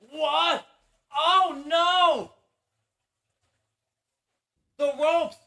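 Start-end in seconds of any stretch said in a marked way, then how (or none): 0.1-2.3 sudden raised gasp of disbelief; shocked surprise. Real, not acted. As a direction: react like the match just turned. Ropes save the pin.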